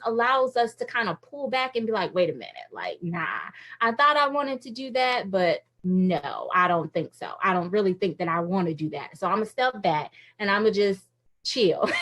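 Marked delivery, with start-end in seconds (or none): 2.1-11.9 low, hesitant voice backing off a calling; mock reluctant inner voice. Speaker imitating her own hesitant self. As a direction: play the doubting self talking herself out of it.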